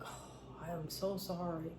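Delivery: hushed, breathy I am so sorry; soft apology. Retold or imitated. Server apologizing softly to an unhappy lady. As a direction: apologize gently under your breath. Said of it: "I was like".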